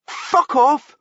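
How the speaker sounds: sharp furious retort; Paul snapping. A narrator's reading. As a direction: bite his head off. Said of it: "Paul snapped".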